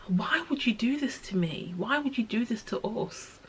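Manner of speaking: high, soft pleading question; thin-voiced, grieving plea; despair. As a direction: ask the one lost, hurt and quietly despairing. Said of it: "the despair".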